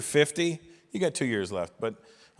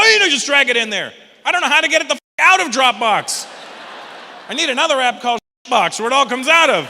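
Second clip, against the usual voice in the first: high, loud frustrated rant; mock yell; 10 semitones higher, 10 dB louder than usual. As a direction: shout at the tech-savvy fool. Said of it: "I go".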